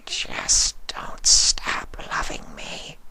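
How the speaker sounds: puzzled creature sensing love; slow wondering changeling. A narrator's reading. Changeling begs.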